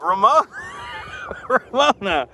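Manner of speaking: loud, drawn-out donor announcement; announcer shout-out. Pretend. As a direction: stretch it out like a hype announcer. Shout-out for a super chat donor.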